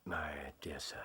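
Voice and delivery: gentle hushed whisper; soft whisper of sympathy. Acted. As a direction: whisper softly to the bereaved. Speaking to a grieving man.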